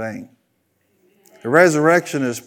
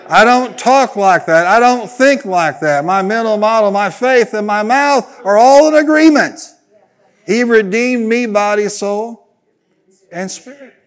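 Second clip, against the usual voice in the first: loud and higher, preaching at full force; triumphant shout; 6 semitones higher, 7 dB louder than usual. Shown as a demonstration. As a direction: shout the confession to the rafters. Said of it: "Come on, say it".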